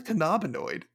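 "cannabinoid" as kuh-NAH-bi-noid, queried; puzzled echo of "cannabinoid" stressed on NAH; mispronounced cannabinoid. Retold or imitated. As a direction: say "cannabinoid" with second-syllable stress and an AH. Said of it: "she called it a cannabinoid".

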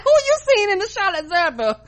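very high, put-on disbelief; mock disbelief. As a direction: question her, incredulous. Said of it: "I looked at her like".

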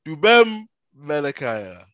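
"Dubem Menakaya" slow and loud, first name stretched; correct name demonstration. Shown as a demonstration. Guest teaching the host his name, drawn-out "Dubem Menakaya". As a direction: model "Dubem Menakaya", holding the final m of Dubem.

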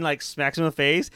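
normal talking voice

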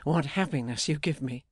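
plain reading voice

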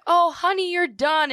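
sweet nurse voice. Retold or imitated.

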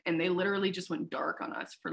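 ordinary talk

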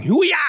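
high, loud karate shout; cartoon karate yell; martial arts yell. Shown as a demonstration. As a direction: belt out a kung-fu battle cry. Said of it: "some kind of martial arts yell".

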